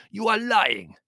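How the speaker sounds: high, loud furious accusation; enraged. A narrator's reading. Servant accusing countess of lying, enraged. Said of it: "almost incoherent with rage".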